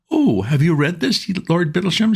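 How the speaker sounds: put-on high sweet young woman's voice; bright and innocent; little woman. A narrator's reading. Wife asking the uncle about the book, high sweet voice.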